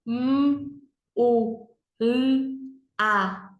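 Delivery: M, U, L, A as "mmm, u, lll, a"; letter sounds. Shown as a demonstration. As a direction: sound out each letter, consonants held. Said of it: "Vamos fazer o sonzinho". (sonzinho de cada letra: "mmm, u, lll, a")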